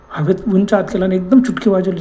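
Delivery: plain reading voice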